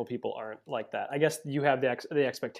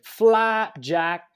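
mock British accent; 9 semitones higher, 9 dB louder than usual. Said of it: "I'm throwing an accent".

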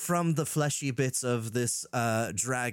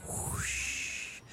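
breathy imitated wave sound; soft wave whoosh; 7 dB quieter than usual. A narrator's reading. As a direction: hush it like a wave. Narrator imitates waves.

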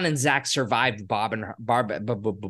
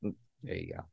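whispering; 6 semitones lower, 14 dB quieter, slower than usual. Real, not acted. Whispered reply after the quote.

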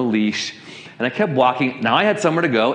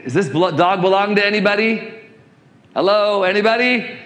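higher-pitched appeal to an unheeding crowd; calling out; 8 semitones higher, 3 dB louder than usual. Retold or imitated. Pastor re-enacting his plea to busy bystanders, calling out. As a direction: call out for anybody to notice.

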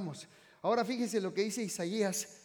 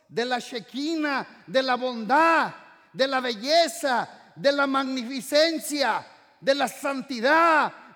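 slow, swelling, ever louder preacher's proclamation; building preaching crescendo; 6 semitones higher, 12 dB louder, slower than usual. Acted. Preacher describing God's holiness to the church, slow building proclamation. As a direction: stack each phrase higher and louder, like climbing stairs.

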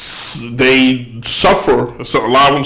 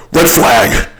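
ordinary talk

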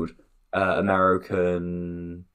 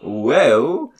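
raised, rising call; drawn-out name call, pitched up; 5 semitones higher, 3 dB louder than usual. Real, not acted. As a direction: call out "Will?" as a questioning summons. Calling out to someone named Will.

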